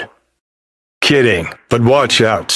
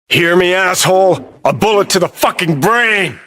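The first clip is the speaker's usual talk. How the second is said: raised-pitch, furious threat; angry threat; 9 semitones higher than usual. Acted. Gunman threatens someone with a bullet, angry and high-pitched. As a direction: spit out a death threat, voice climbing high.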